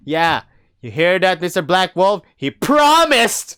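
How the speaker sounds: loud, high taunt rising to a yell; triumphant shout. Acted. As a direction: crow it, ending in a drawn-out yell. Mock taunt at a character.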